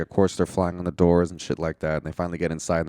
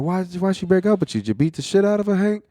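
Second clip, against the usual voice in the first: mock girlfriend voice; 12 semitones higher, 4 dB louder, faster than usual. Retelling the ex-girlfriend's accusation.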